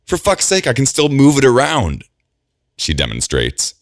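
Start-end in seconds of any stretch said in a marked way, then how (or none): 0.0-2.0 strained, high cry of pain; through gritted teeth in pain. Acted. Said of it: "gritting her teeth through the pain".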